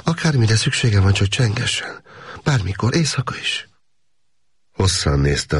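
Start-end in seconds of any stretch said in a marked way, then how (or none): 0.0-3.7 higher, eager woman's voice; a woman offering help at any hour; a lightly voiced young woman, eager to help. A narrator's reading. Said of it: "mondta a nő".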